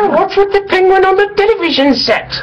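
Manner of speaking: very high shrill British Python lady voice; Monty Python falsetto. Retold or imitated. Doing Monty Python for his British co-host, very high British falsetto. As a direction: squeak it like a Monty Python housewife. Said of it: "I watched a lot of Monty Python".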